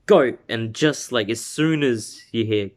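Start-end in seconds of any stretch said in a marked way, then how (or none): 0.1-0.4 sudden loud, higher shout; starter's call. Retold or imitated. Acting out race start signal.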